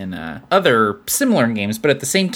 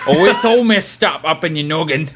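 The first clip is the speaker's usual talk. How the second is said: Cockney surgeon delivering bad news; Cockney accent; 2 semitones higher than usual. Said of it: "The Cockney".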